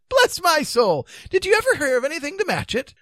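high, loud exclamation; amused amazement. A narrator's reading. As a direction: exclaim high in delighted disbelief. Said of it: "began to laugh".

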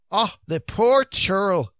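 high, slow cry of pity; drawn-out Scots sympathy; compassionate. A narrator's reading. Old wives tending the fainted knight, compassionate. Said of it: "they said compassionately".

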